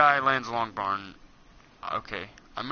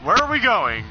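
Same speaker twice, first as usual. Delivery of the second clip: higher and louder surprise; startled shout; 8 semitones higher, 8 dB louder than usual. Real, not acted. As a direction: blurt it out, caught off guard. Startled shout mid-flight.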